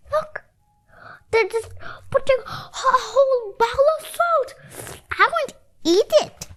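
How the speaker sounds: high, complaining voice; a high, disgusted refusal to eat; disgusted child. Acted. Reacting to salty soup.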